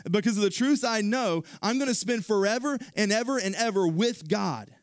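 high, loud preaching voice; full-force preaching. Acted. Preacher driving the point home, full force. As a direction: preach it hard to the congregation.

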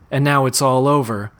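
usual speaking voice

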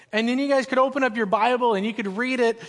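ordinary delivery

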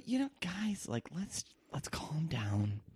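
airy whisper of a chill peacemaker; laid-back whisper. Retold or imitated. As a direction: breathe it out softly, all calm. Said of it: "I can really capture this person".

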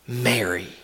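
low, soft, intimate naming of her; tender call. Retold or imitated. Jesus gently calling the weeping Mary by name, low and soft. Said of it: "a single word spoken in the right tone".

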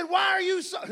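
loud, high-pitched challenge; shouted rebuke. Retold or imitated. The Lord rebuking Doctor Schuler, shouted rebuke. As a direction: fire it out as a divine scolding.

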